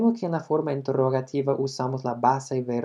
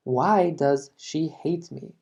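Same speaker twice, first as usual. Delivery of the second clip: slow model with why stressed; slow classroom repeat, why leaned on; 2 semitones higher, slower than usual. Shown as a demonstration. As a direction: repeat it slowly, leaning on why. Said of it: "Otro ejemplo".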